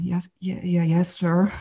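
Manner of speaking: soft, stammered mock-timid answer; fearful. Kid answering Dad on summer tour, imitated timid stammer. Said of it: "I'm fear and trembling".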